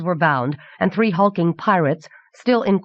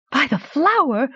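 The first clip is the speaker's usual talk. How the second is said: high, astonished exclamation; shocked gasp; 9 semitones higher than usual. A narrator's reading. Anagel reacts to the sorcerer's power, gasping. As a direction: let the pitch leap in astonishment. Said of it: "gasped Anagel".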